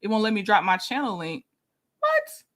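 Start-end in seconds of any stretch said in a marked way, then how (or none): 2.0-2.4 what shot up very high; a startled squeak of disbelief; real surprise, pitch leaping way up; surprised what. Real, not acted. Link won't post, surprised.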